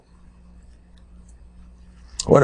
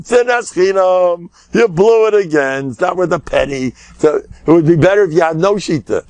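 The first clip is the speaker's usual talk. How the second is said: normal talking voice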